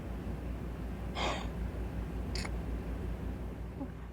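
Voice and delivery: long, soft acted sigh; spent sigh. Believer spent after venting at Jesus, long weary sigh. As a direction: let out all the air, worn out. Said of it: "when you're finished venting, how tired you feel".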